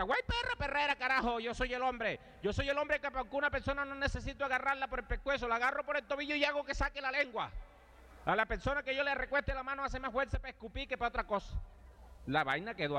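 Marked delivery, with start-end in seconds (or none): 0.0-11.6 high, blustering brag opening on a shrieked güey; boastful yell. Retold or imitated. Father's rival boasting to the crowd, high blustering yell. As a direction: crow about your strength, pitched up throughout. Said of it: "Y uno grita".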